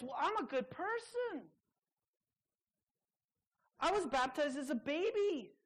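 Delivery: high, self-satisfied mock voice; mock smug churchgoer. Preacher sending up a smug churchgoer, high and self-satisfied.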